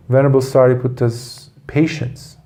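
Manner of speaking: ordinary delivery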